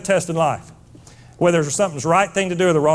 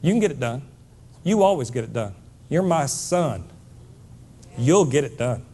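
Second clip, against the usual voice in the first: lightly voiced mother, low and soft; low, steady motherly encouragement; reassuring mama; 4 semitones lower, 3 dB quieter than usual. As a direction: quietly insist on faith in your son. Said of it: "She said".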